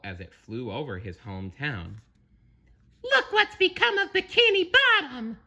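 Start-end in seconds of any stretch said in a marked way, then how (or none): 3.0-5.4 very high, dismayed cartoon voice; SpongeBob crying out. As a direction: cry out at the ruined town. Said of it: "he cried".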